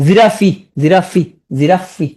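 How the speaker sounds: "জিরাফি" with an added final "ফি"; spelling pronunciation. Shown as a demonstration. Teacher showing how to pronounce giraffe for spelling, ending voiced. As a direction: slowly sound the last syllable of "জিরাফি". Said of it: "উচ্চারণ করবে কি".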